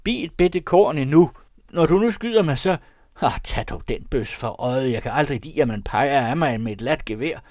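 high, loud frantic pleading; skrigende. A narrator's reading. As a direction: beg at full voice with the gun on you. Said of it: "skreg Mads igen". (højt, angst og bedende)

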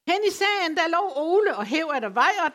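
folksy West Funen storytelling voice; West Funen dialect reading. Shown as a demonstration. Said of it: "læse en lille bitte stump ... på vestfynsk".

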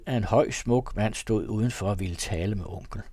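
plain reading voice

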